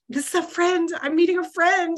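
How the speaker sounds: high, giddy excitement; thrilled at a brand-new friend; excited. Retold or imitated. Extrovert realizing she is making a friend, excited. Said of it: "that was so exciting for me".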